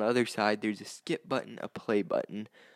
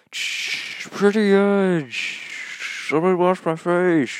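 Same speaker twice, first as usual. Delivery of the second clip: high, loud and distant, slow; shouting from the shower over the water; from another room; 8 semitones higher, 6 dB louder, much slower than usual. Shown as a demonstration. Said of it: "let me demonstrate what it would sound like".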